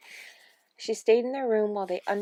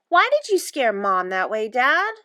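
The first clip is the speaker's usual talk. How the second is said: louder, scolding question; stern; 4 semitones higher, 7 dB louder than usual. A narrator's reading. Anne takes her father to task, stern. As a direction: demand an explanation, lifting on the last word. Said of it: "And Anne said sternly".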